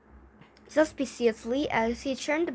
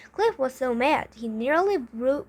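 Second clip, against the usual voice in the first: usual speaking voice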